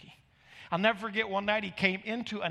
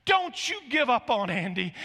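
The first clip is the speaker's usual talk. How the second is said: imitated loud, raised plea; crying out; 7 semitones higher, 7 dB louder than usual. Raul begging pastor for his jailed son, crying out. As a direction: plead loudly, pitched up. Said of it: "he cried out".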